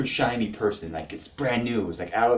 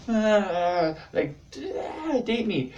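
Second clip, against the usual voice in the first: high squealed plea; hammed-up high begging; mock desperate plea; 11 semitones higher, much slower than usual. Retelling own crush getting out of control, mock desperate whine.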